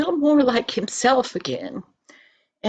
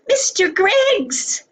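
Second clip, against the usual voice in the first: put-on much higher, slow, delighted call of a little girl; excited greeting; 10 semitones higher, 3 dB louder, slower than usual. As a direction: stretch the name out with glee. Linda runs in to greet him.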